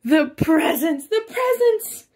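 high, loud greedy scream. Acted. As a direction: shriek it out like a spoiled pig. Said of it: "he screamed out again".

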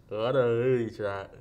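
slow, mushy words past a mouth guard; mouth guard speech. Real, not acted. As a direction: talk with a guard in.

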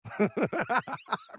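short derisive laugh through the nose; scornful laugh. Acted. Old boss scoffs at retirement, scornful laugh.

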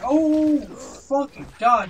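high, loud cry of alarm; startled outburst. Real, not acted. Gamer caught out by a fast enemy, startled yell. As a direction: burst out loudly, then drag the curse.

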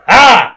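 high loud shriek; startling scream. Pretend. Screaming back, mock fright.

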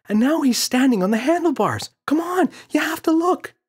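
put-on very high, loud child voice; excited child. Boy telling father the gorilla dances, excited. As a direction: gush it like a thrilled kid.

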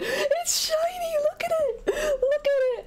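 very high, gushing squeal of delight; thrilled squealing. Real, not acted. Streamer shows off the shiny Kyogre, thrilled squealing. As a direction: break off and squeal with joy.

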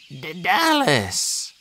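slow, high, weak croak; strained, faltering croak. A narrator's reading. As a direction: croak the name out with effort. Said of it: "he croaked".

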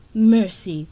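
loud, scornful hiss. A narrator's reading. Queen answers the captive's plea, hissed. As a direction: throw the word back with force. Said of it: "Coral hissed".

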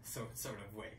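ordinary delivery

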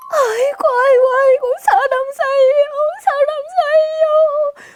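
loud, high wailing cry; a desperate mother's wail for help. Acted. Mother crying out for help, loud high wailing.

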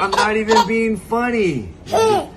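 can't stop giggling; laughing. Real, not acted.

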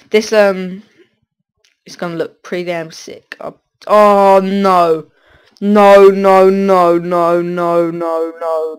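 ordinary talk

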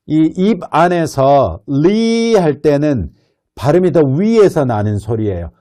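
ordinary talk, nothing marked

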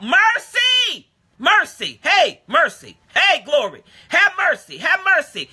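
high, drawn-out cry for mercy; beggar calling out. Acted. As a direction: cry out to be noticed by Jesus. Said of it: "So the beggar calls out".

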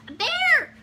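very high, very loud shriek; storybook scream. A narrator's reading. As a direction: scream in mock terror for children. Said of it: "screamed Eddie".

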